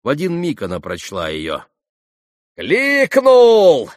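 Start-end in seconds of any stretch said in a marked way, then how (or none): 2.6-3.9 very high, loud, drawn-out shout; wild ringing exclamation; piercing cry. A narrator's reading. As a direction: scream it out, stricken. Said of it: "прокричала она".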